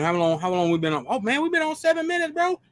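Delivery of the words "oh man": ordinary talk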